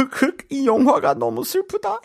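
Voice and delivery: very high, fast hammed-up sobbing; mock sobbing. Teacher performs a sad-movie line for students, mock sobbing. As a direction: sob theatrically over a sad movie. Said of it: "Why are you so good at this".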